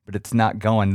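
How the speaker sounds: normal talking voice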